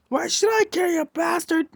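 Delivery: high, irate acted yell without full volume; mock yelling. Hothead insulting a classmate being nice, mock yelling. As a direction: play a hothead throwing an insult. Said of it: "stop yelling at me".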